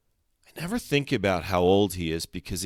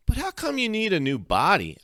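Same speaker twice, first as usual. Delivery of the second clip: puzzled; 13 semitones higher than usual. A narrator's reading. Narrator boy asks friend, puzzled.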